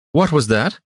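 high, indignant demand; affronted official's sharp question; demanding herald. A narrator's reading. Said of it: "the herald demanded".

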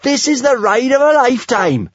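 high, thrilled shout over the wind; high-pitched, elated holler; whooping. A narrator's reading. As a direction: call out in sheer exhilaration. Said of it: "whooped Kamikaze above the roaring".

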